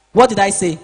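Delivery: loud, pressing question to the class; teacher's loud demand. Real, not acted. Teacher pushing students to repeat the rule, loud. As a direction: demand the class answer right away. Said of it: "teacher demanding class repeat".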